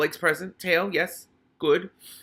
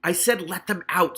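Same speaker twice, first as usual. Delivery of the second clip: louder, higher screaming rage; screamed; 6 semitones higher, 5 dB louder than usual. Acted. Hammond Pork screaming at the humans to free the rats. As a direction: scream the order, trembling with rage. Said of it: "screamed Hammond Pork".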